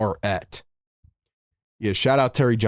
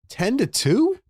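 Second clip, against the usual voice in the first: disbelief; 12 semitones higher, slower than usual. Real, not acted. Reacting to opponent run.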